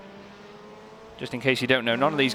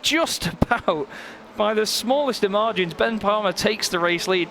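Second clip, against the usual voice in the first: higher, rising call as the lead changes hands; excited commentary; 8 semitones higher than usual. Real, not acted. Commentator calling Palmer's pass at pit exit, excited. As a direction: call the finish of a tight pit-exit battle.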